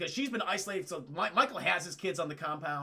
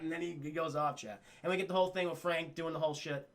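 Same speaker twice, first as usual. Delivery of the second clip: normal talking voice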